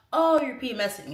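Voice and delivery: mock dismissive. People brushing off her depression, mocked loudly. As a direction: toss it out loudly, mocking the speaker.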